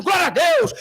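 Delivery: high loud shouted praise; congregation shout. Retold or imitated. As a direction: cry out like a fired-up crowd.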